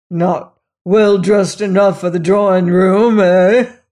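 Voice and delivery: loud, gruff, bitter; resentful jab. A narrator's reading. Robert resenting the maid's choice of room, loud and bitter. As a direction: throw the jab loudly at her.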